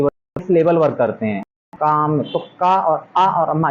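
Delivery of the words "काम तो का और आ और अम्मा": काम said whole, then broken into का and आ and अम्मा; splitting a word into syllables. Shown as a demonstration. Teacher to class showing syllables, slow sounding-out. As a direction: sound out काम piece by piece, slowly. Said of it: "ये क्या syllable".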